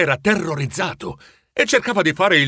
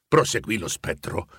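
plain reading voice

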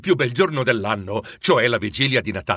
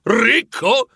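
much higher, louder outraged repeat; scandalized; 8 semitones higher, 4 dB louder than usual. A narrator's reading. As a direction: spit the word back in disbelief, climbing up. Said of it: "ribatté Scrooge scandalizzato".

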